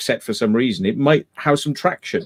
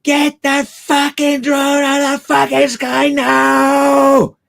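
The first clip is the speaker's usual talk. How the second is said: very high, drawn-out mock scream, swelling on the last word; imitated furious shout; 12 semitones higher, 4 dB louder, slower than usual. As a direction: imitate an enraged megaphone yell, slowly, at full pitch. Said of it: "the complete inflection that was coming from one".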